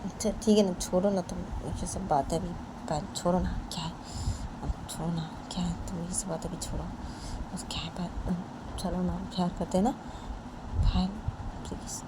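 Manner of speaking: quiet intimate persuasion; soft pleading. Acted. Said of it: "Poonam वो पायल के पास आके बोलती है".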